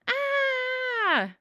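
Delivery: long, high, loud scream; sugar-rush scream. Acted. Alvin running wild on sugar, screaming. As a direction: yell at the top of your lungs. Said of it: "I screamed at the top of my lungs".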